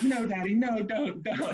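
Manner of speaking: high, pleading mock voice; mock frantic pleading; pleading bride. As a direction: beg daddy to stop, pitched high. Bride stopping her dad.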